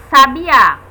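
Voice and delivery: sabiá lento, com o Á final forte; tônica em sabiÁ. Shown as a demonstration. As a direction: alongue e reforce o final, sabiÁ. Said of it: "Vejam a diferença". (sabiÁ, última sílaba bem marcada)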